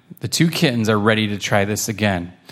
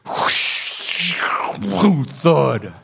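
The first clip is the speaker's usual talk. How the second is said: whoosh and thud; 4 semitones higher than usual. Acted. Rocket sound effects.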